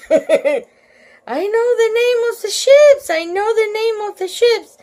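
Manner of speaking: high, urgent call from a pupil; excited schoolkid shouting out. A narrator's reading. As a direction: call it out loudly, bursting to answer. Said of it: "he called out".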